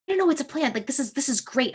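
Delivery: high, eager insisting; excited reassurance. Retold or imitated. Speaker telling a worried husband it's just a plant, high and eager. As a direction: brush off his worry, too thrilled to stop.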